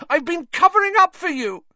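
high, loud, betrayed shriek. A narrator's reading. Hermione who kept Lupin's secret, shrieking at him. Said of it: "Hermione shrieked".

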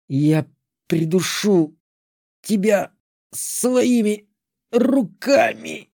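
медленный хрип с угрозой; прохрипи угрозу сквозь боль; хрипло. A narrator's reading. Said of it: "прохрипела Алантри". (хриплая угроза)

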